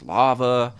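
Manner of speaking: ordinary talk, nothing marked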